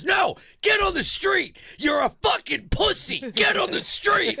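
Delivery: very high, loud shouted order; imitated screaming. Friend imitating her screaming at a scooter rider, high and loud. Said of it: "You just scream back".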